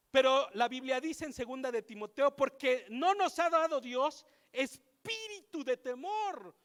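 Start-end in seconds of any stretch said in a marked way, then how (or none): none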